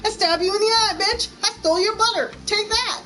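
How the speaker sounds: hammed-up cutthroat baker; mock vicious American. Mocking mean American bakers, high laughing mock threat. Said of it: "we're like".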